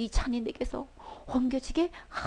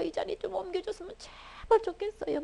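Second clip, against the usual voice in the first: higher, softer, half-weeping begging; tearful plea; 8 semitones higher, 7 dB quieter than usual. Retold or imitated. Jesus weeping in prayer to the Father, soft tearful plea. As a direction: plead softly through tears. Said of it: "막 이렇게 눈물로 기도하셨단 말이에요".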